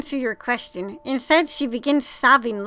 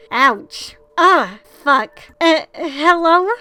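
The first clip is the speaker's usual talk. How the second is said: put-on startled hurt girl; 3 semitones higher, 6 dB louder, slower than usual. Hurt girl calls out in dark.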